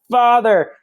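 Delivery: high, loud burst of recognition; joyful cry. Retold or imitated. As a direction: call out to a long-lost father. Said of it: "is like".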